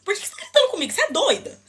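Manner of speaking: high-pitched, incredulous challenge; indignant retort. Retold or imitated. Customer confronting a yelling clerk, indignant retort. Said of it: "aí eu falei desse jeito".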